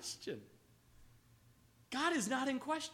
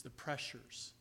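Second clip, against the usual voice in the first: usual speaking voice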